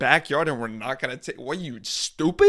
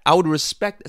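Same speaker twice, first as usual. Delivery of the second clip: ordinary talk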